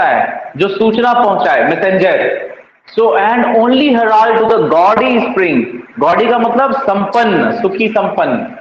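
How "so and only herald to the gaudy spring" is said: very slow, weight on only; slow verse recital. Shown as a demonstration. Teacher reciting the sonnet line to students, very slowly. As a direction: read the line out slowly, word by word.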